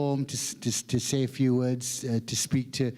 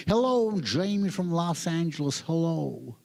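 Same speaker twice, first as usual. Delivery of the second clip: mock American introducing himself with swooping tune; American organizer. American organizer working the room introducing himself, hammed-up American accent. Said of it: "the American organizer comes in".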